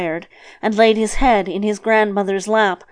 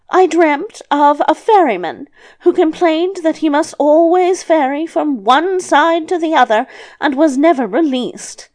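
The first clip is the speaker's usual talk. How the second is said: put-on high, louder, animated old woman; grandmother telling a dream; 7 semitones higher, 4 dB louder than usual. Grandmother telling the devil her second dream, high-voiced. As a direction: raise the pitch right up for the grandmother.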